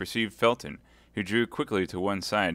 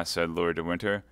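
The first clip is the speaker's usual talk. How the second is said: plain reading voice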